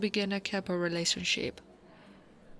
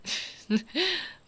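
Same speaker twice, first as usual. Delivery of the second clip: higher, short laugh; amused giggle; 10 semitones higher than usual. Acted. Orc woman asked for a hug, amused giggle. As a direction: giggle lightly at the request.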